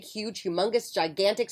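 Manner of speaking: usual speaking voice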